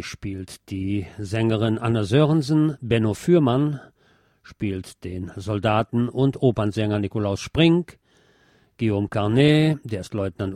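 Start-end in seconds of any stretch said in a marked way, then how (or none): none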